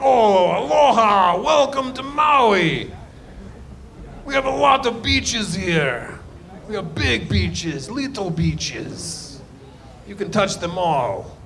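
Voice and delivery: drawn-out, booming mock Russian welcome; high, slow comic Russian accent, easing off toward the end. Comic greeting Maui tourists as car rental clerk, hammed-up Russian accent. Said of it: "like, with Russian accent".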